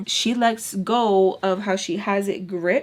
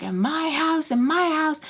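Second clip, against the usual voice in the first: high-pitched indignant repeat; yelling; 8 semitones higher than usual. Retold or imitated. Tommy ranting about her house, high indignant yell. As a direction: hammer home whose house it is. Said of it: "she starts yelling at Sugar".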